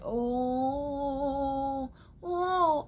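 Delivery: swooning oh. Pretend. Swooning exclamation.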